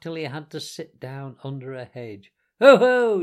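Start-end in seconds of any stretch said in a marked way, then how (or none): 2.6-3.2 loud, high crowing exclamation; ecstatic self-admiration. A narrator's reading. Toad after his escape, crying out in gleeful self-admiration. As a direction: crow with delight at yourself. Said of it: "he cried, in ecstasies of self-admiration".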